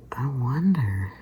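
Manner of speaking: mock-pensive, lingering delivery; drawn-out wondering. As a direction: stretch it out as if pondering aloud. Acts out own pondering thought.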